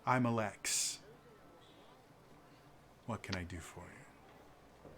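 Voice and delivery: quiet, low, courteous apology; smooth a diplomatic apology over softly; soft-spoken noble. Acted.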